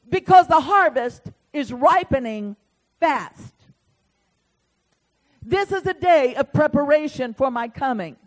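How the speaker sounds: loud, high prophetic preaching; preaching at full force. Acted. Preacher proclaiming God's word to his congregation, full force. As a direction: thunder the prophecy out to the church.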